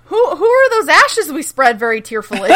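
words bubbling with laughter; laughing. Real, not acted. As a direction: laugh through the question. Cracks up joking about ashes.

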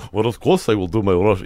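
hint of a Russian accent. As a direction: answer in a light Russian accent. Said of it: "do your Russian accent again".

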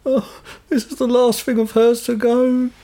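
high, slow mock lament; lightly put-on grieving daughter; imitating tearful woman. Retelling a bereaved woman handing over mum's car, mock tearful. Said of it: "she's there crying".

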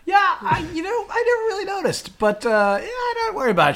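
high, hammed-up innocent voice. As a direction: act innocent in a squeaky put-on voice. Voicing Kennedy, high mock voice.

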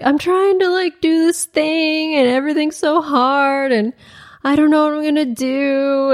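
half-hearted teary pleading; mock crying plea. Anna begging her trainer in tears, mock crying whine. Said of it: "Anna's down there crying".

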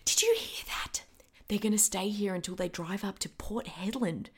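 hushed conspiratorial whisper; whispering while eavesdropping on the men. Acted. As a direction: whisper so the men don't hear.

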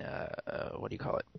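unvoiced whisper, searching; whispered groping. Real, not acted. Trying to recall a name, real whisper. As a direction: mutter while thinking. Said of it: "groping for word".

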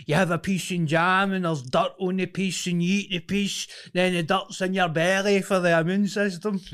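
high, loud indignant mock rant; hammed-up high-pitched nostalgic rant; ranting anti-masker impression. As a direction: imitate a man sounding off to a crowd. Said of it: "he was basically like".

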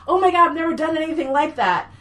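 high, loud amazed quote; excited gushing. Retold or imitated. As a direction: imitate someone gushing in amazement. Retold gushing after the scene.

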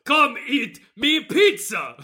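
slow, high Italian monster voice; cartoon Italian-monster invitation; monster pizza guy. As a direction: hawk food like an Italian monster. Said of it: "They all have the same voice".

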